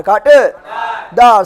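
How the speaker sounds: कांटेदार slowly, broken as कांटे… दार; drawn-out drill. Shown as a demonstration. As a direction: stretch and split कांटेदार for the class to echo. Teacher splits term for class.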